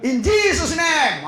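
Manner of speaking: slow, drawn-out declaration of the name; slow preacher's invocation; prayer proclamation. Shown as a demonstration. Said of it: "pakai Yesus kok".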